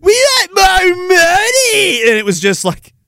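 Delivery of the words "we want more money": high, slow, wailing mock chant; mocking protesters. Mocking striking teachers chanting their demand, high and slow. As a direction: whine it like crying protesters. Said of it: "they're all protesting".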